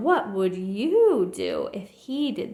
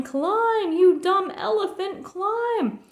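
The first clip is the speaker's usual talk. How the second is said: high, loud bossy yell; 9 semitones higher, 4 dB louder, slower than usual. A narrator's reading. Quuggerbug driving Horton uphill, a high loud yell. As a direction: bark it down from the trunk. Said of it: "kept yelling".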